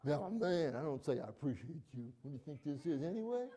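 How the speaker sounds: half-mocking gruff man; mock gruff guy. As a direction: play a tough guy scoffing at feelings. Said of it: "gruff and rough".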